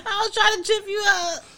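shouting through loud laughter; laughing. Real, not acted. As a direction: crack up while confessing. Laughing after tricking him.

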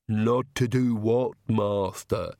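slow, lisped servant's question; Igor, lisping servant. Acted. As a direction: ask it slowly with a lisp. Servant Igor voiced with lisp.

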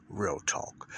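breathy whisper of the show name; whispered title. Acted. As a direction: whisper the title like a jingle tag. Podcast title whispered in intro.

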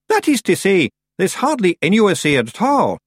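high, loud Scots creature voice; Scottish Feegle. A narrator's reading. Hidden Feegle covering up to Tiffany, high loud evasive Scots. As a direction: cover up as a cornered little Feegle.

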